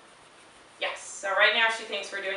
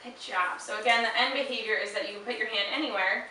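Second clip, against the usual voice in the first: ordinary talk, nothing marked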